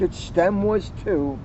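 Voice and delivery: ordinary talk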